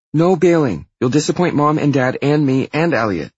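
loud, high-pitched warning; insistent little sister. Acted. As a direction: pressure your brother, loud and high. Sister pressures brother about party.

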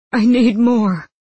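high, loud desperate plea; begging. A narrator's reading. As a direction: beg as if about to crumple. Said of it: "he begged, his face crumpling".

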